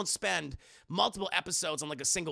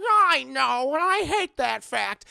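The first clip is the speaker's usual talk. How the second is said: high, loud whiny complaint; whiny little boss; 7 semitones higher, 9 dB louder, slower than usual. Retold or imitated. Koenma answering the ogre, high loud whine. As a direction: sulk about losing the bet.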